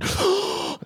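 sharp, high imitated gasp; mock scared gasp. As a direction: gasp like a startled kid. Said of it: "they would get so scared".

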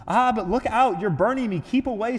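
loud, high cry of alarm. A narrator's reading. Ghost to the burning angel as it backs away, alarmed.

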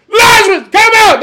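very high, loud shout; shouted summons. Acted. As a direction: call out the dead with full force.

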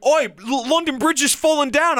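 mock Cockney, pitched high; hammed-up bad London accent shout-out; high, badly faked Cockney cry; horrendous Cockney. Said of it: "Speaking of that horrendous accent".

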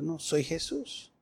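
quiet correction. Acted. Jesus correcting Saul, soft and slow. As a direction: hush it, a calm correction.

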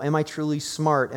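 ordinary talk, nothing marked

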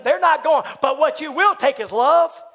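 high, loud driving sermon climax; full-force preaching. Real, not acted. Preacher tells congregation what Christ comes for, loud impassioned preaching. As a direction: drive every phrase home at full voice.

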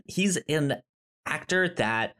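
usual speaking voice

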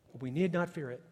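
ordinary delivery

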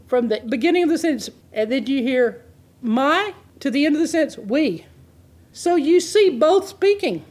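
usual speaking voice

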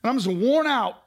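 high, pressing list of exhaustion; weary confession. Retold or imitated. The worn-out believer speaking to God, pressing and weary. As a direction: pour out every burden. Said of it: "when you say".

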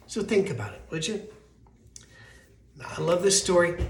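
ordinary delivery

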